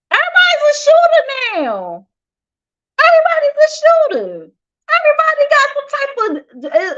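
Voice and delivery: high, sing-song disbelief; stretched, high, exasperated wail; incredulous. Real, not acted. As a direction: throw the words up in mock despair. Incredulous rant about shootings.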